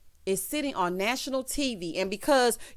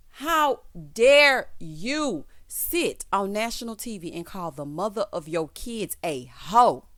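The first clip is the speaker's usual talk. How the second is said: slow, emphatic telling-off; indignant scolding; slower than usual. Real, not acted. Host scolding husband for insulting his wife on TV, slow and indignant. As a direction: space the opening words out in outrage.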